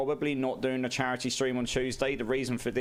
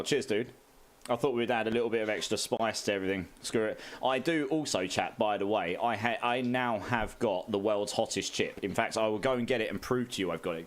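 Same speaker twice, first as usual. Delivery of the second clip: ordinary talk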